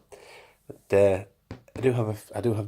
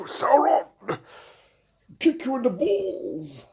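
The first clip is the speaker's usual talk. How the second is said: mock villain voice; 14 semitones higher, much slower than usual. Voicing the angry figure.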